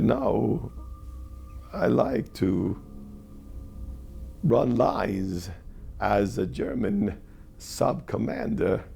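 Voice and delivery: slow, halting imitation with long pauses; Chris Walken. Co-star explaining his rehearsal habit, slow halting impression. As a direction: draw it out word by word, imitating the actor. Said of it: "He said".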